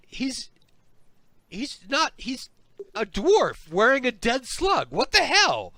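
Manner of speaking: high-pitched, baffled gnoll voice; bewildered gnoll. Acted. Gnoll confronted by a dwarf in a dead slug, incredulous. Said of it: "the Knoll says".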